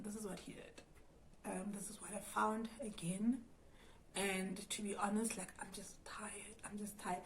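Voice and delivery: whispered and worn out, barely voiced; tired whisper. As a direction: confide it in a defeated whisper. Tells cousin after crying.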